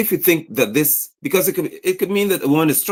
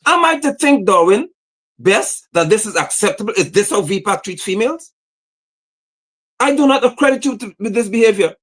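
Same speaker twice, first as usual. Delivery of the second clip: pitched up, pressing every question hard; indignant challenge; 6 semitones higher than usual. Real, not acted. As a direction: call him out to his face, demanding an answer. Demanding a leader answer publicly.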